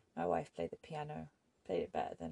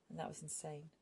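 ordinary delivery, nothing marked

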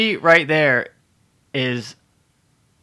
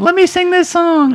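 very high, loud, drawn-out imitation; slow, high-pitched pleading mockery; mock eager plea; 5 dB louder, slower than usual. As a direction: mimic an overeager fan begging the singer. Mimics woman begging to sing.

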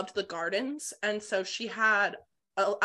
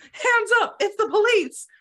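high, loud playful police call; mock police shout; 12 semitones higher, 7 dB louder than usual. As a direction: call out a pretend police raid. Playful acting out of police raid.

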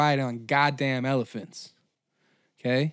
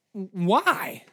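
usual speaking voice

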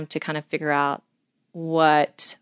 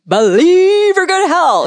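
much higher, louder and slower, a hammed-up preacher's warning; mock hellfire preacher; 13 semitones higher, 10 dB louder than usual. Telling the host what her mission is not, in a mock preacher's boom. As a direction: belt it like a fire-and-brimstone preacher, half mocking.

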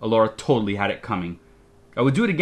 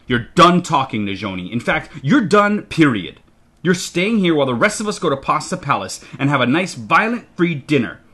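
loud, emphatic parental tirade; furious scolding; 5 semitones higher, 5 dB louder than usual. A narrator's reading. Father grounds his child while the family goes out, furiously. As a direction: lay down the law, building to a high demand.

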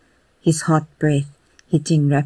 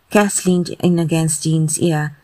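ordinary delivery

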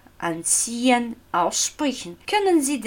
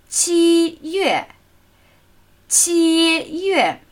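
"qi" high and level, "yue" falling from high; first then fourth tone; 7 semitones higher, slower than usual. Shown as a demonstration. Teacher showing the month July, high then falling tone. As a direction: hold "qi" up, then let "yue" drop. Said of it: "Der erste Ton und der fallende vierte".

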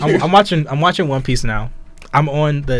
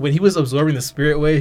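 normal talking voice

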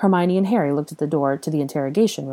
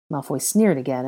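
usual speaking voice

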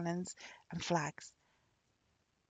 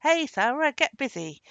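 loud, bossy summons; high shouted call, dropping to a firm order; crying out; 7 semitones higher, 10 dB louder than usual. A narrator's reading. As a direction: call a servant to work.

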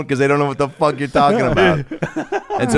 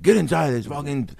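higher-pitched parental scolding; strict parents; 6 semitones higher than usual. Retold or imitated. Parents calling kids in on Friday night, scolding. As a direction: order the kids indoors, high and urgent.

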